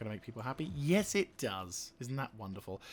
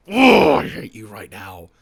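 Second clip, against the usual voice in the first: much louder, slow hateful outburst; real loathing; 3 semitones lower, 14 dB louder, slower than usual. Real, not acted. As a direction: pour hatred into it, slowly.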